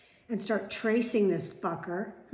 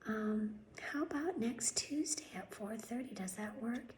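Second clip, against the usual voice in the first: mocking receptionist whisper; 13 dB quieter than usual. Mocking the doctor's office receptionist, soft whispered voice. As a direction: mimic her limp, whispery phone voice. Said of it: "this is how they talk".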